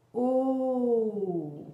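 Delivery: long "O" falling slowly; sustained vowel. Shown as a demonstration. Teacher pairing the O with its hand gesture, sustained vowel. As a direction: draw out "O" with rounded lips. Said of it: "j'accompagne donc du geste de Borel".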